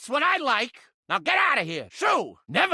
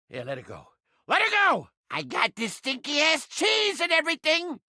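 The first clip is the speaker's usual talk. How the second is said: usual speaking voice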